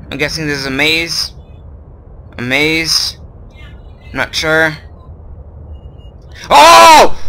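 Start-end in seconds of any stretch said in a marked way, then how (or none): 6.5-7.2 sudden high yelp then gasp; startled shriek. Real, not acted. Gamer startled by a scare, high shriek. Jump scare in horror game.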